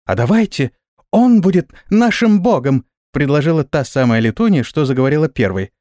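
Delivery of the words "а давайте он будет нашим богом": high, excited little voice; eager proposal. A narrator's reading. Flying girl proposes a god to her friends, eager. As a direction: pitch it up with childlike enthusiasm.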